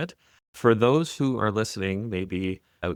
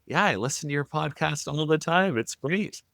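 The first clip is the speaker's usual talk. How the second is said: light imitation of George, pitched up; a hint of an eager fan, raised pitch; voicing George, higher; 7 semitones higher than usual. Host retells George praising his podcast, lightly voiced higher. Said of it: "And George says to me".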